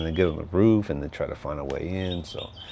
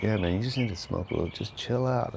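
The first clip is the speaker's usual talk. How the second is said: laid-back stoner friend; 3 semitones higher than usual. Retold or imitated. Friend urging him to smoke, lazy mock stoner. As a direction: lightly play the stoner buddy. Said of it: "He's like".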